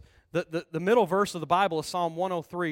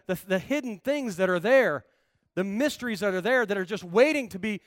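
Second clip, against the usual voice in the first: usual speaking voice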